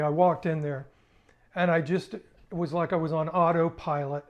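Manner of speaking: ordinary talk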